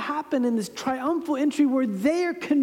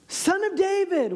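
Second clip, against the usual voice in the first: high calling out; shouting; 9 semitones higher than usual. Retold or imitated. Blind beggar calling out to Jesus, high shout. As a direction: cry out to be heard. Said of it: "begins to start shouting".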